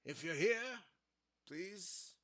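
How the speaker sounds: ordinary delivery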